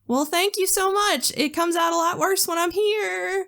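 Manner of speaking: high, put-on customer-service sweetness; gushing politeness. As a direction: gush with thanks, ending in a fake laugh. Retold gushing Southern customer-service reply.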